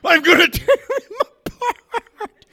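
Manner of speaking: very high, loud, slow threat dissolving in giggles; can't keep a straight face while threatening; giggling threat. Retold or imitated. Wrestler threatening the camera but giggling helplessly. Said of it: "And Mad Dog got the giggles".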